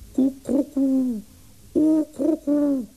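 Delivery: very high falsetto coos; pigeon cooing. A narrator's reading. Larry luring his bird from the pole, cooing in falsetto. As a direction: imitate a pigeon to lure it down. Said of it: "te koeren".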